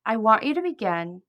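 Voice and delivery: guided imagery. Acted. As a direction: ease the listener gently into relaxation, pausing between phrases. Leads guided imagery script.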